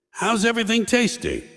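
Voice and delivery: high, sing-song waiter check-in; lightly voiced interrupting waiter; mock waiter. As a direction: chirp it like an eager server. Waiter swoops in.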